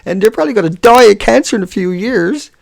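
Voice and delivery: high, loud mock boasting to Mom; mock proud child. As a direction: sarcastically play a commenter bragging to a parent. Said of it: "You go home and say".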